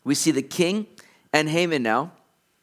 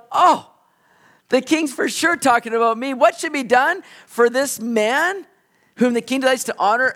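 higher, louder, gloating self-important voice; smug Haman; 7 semitones higher, 4 dB louder than usual. Retold or imitated. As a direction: preen like a man sure the honor is his. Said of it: "He's going".

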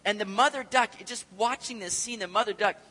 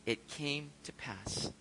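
slow hushed reading; 5 semitones lower, 10 dB quieter, much slower than usual. Acted. Looking back on deliverance.

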